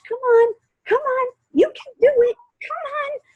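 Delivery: very high-pitched pet-coaxing voice, imitated. Runner imitating her helper urging her on like a pet, high-pitched coaxing. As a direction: sing out encouragement like calling a beloved dog. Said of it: "call me, my, like, her most prized pet".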